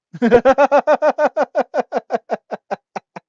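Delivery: high, loud laughing fit; real sustained laugh; long loud cackle. Real, not acted. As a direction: collapse into laughter. Cracks up at own joke.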